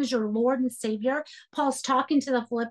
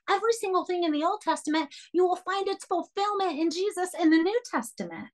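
fast, raised preaching push; fervent preaching; 6 semitones higher, faster than usual. Acted. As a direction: drive the point home to the congregation. Preaching with rising fervor.

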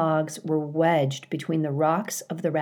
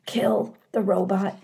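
growled; 4 semitones higher than usual. Acted. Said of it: "Mother Bear growled".

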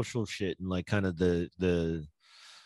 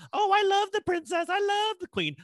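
much higher, gushing fangirl voice; mock royal fan. Mocking Americans who adore royals, gushing high fan voice. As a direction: swoon over the queen in a put-on voice. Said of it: "We over here".

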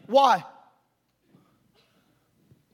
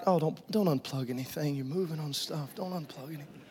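soft, anxious fussing; lightly voiced worried tech; imitating sound men; 5 semitones lower, 9 dB quieter than usual. Imitating the sound men fussing over cables, soft. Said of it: "Sound men are like".

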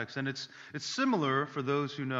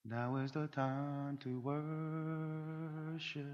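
worship leader voice; 9 dB quieter than usual. Retold or imitated. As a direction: lead the congregation gently.

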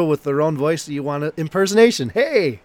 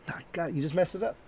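ordinary talk

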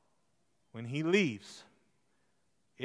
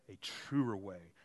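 ordinary delivery

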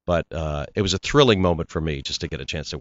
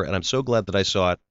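ordinary delivery

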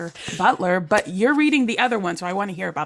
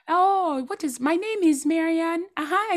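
high-pitched, lilting Nigerian accent; former Nigerian accent; 11 semitones higher than usual. Shown as a demonstration. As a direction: slip back into your old Nigerian accent. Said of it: "my accent before was mushed out of me".

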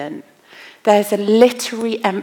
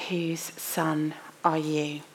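hushed whisper; 4 semitones lower, 11 dB quieter, slower than usual. Acted. Dramatic question put to David.

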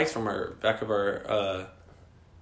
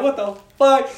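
high, loud yell of alarm; retold scream; 13 semitones higher, 7 dB louder than usual. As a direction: cry out in comic panic. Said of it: "I screamed".

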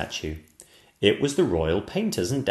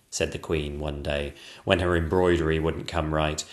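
ordinary delivery

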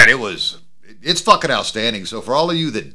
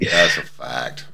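deep slow voice; 9 semitones lower, 3 dB quieter, much slower than usual. Pretend. Joking bit.

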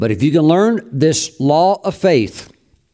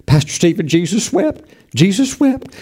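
mock questioner; 6 semitones higher than usual. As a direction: plead with the pastor in a thin high voice.

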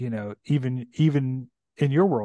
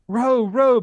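loud, high shouted order; bellowed; 11 semitones higher, 8 dB louder than usual. Acted. Caspian shouts at his rowers in panic, bellowing. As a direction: bark the oars into motion. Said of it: "bellowed Caspian".

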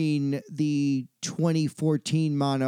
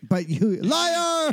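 playful high-pitched "liar!" jab; mock accusation; 11 semitones higher, slower than usual. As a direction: teasingly call him a liar. Teasing friend as liar.

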